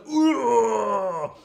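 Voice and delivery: loud, high staggered grunt; mock pained grunt. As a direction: grunt like a blinded killer. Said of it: "the guy's like".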